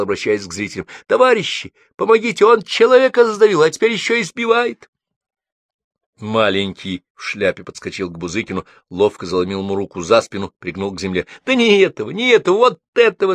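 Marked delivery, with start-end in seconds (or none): 1.1-4.9 отчаянный высокий громкий крик; зови прохожих на помощь; крик о помощи. A narrator's reading. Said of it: "Алла закричала". 11.4-13.3 взволнованный высокий крик; перекричи всех, указывая на виновного. A narrator's reading. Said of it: "закричала".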